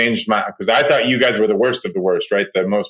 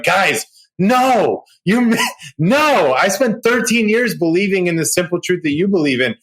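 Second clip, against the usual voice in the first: higher, laughing, urgent pushback; a laughing, pitched-up protest, Guys, no; excited protest; 6 semitones higher than usual. Retold or imitated. Retelling his reply to the Bible study group, higher and laughing. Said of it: "And I'm like".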